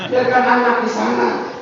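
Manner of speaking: normal talking voice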